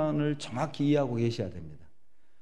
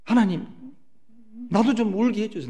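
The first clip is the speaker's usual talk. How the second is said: pleading prayer; 7 semitones higher, 3 dB louder than usual. Retold or imitated.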